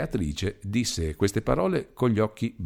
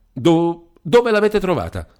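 higher, louder, stumbling start; startled stammer; 9 semitones higher, 6 dB louder than usual. A narrator's reading. Demetrio startled that Beatrice found the letter, stammering. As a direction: caught off guard and flustered.

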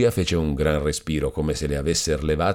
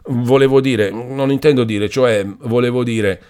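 flustered stammering; 6 semitones higher, 5 dB louder than usual. A narrator's reading. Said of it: "s'andava intrigando e imbrogliando".

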